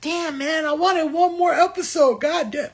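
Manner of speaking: mock fan meltdown, loud; frustrated outburst. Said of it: "you almost wanna say".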